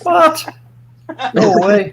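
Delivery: high, shocked "What?". Real, not acted. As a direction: blurt it out in shock. Friend takes seven sugars.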